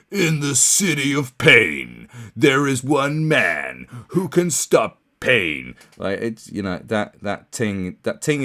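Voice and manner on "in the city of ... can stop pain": slow, loud movie-trailer narrator; gruff trailer voice. Retold or imitated. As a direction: boom it out like an old film trailer. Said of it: "the gruff voice man going".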